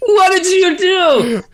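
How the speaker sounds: high, drawn-out mock outrage; mock accusing shout. Co-host mocking the driver who blamed his friend, shouted accusation. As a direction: yell it slowly as a hammed-up accusation.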